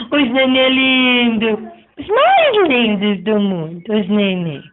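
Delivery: ordinary delivery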